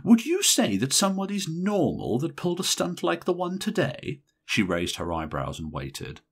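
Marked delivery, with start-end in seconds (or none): 0.0-4.2 high, heavily ironic appeal; maximum irony. A narrator's reading. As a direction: lay the sarcasm on thick. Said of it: "appealed to Missus Silsburn with a maximum of irony".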